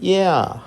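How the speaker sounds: ఏ ఆ sounds glided into one; blend e and a. Shown as a demonstration. Teacher demonstrates the vowel blend slowly. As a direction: say ఏ then ఆ smoothly joined. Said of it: "ఏని ఆని కలపండి".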